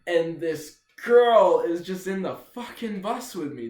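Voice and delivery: ordinary delivery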